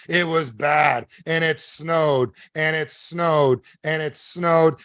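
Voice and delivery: very slow, stretched key words; drawn-out storytelling. Retold or imitated. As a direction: draw out each phrase for weight. Stressing how bad the snowstorm was.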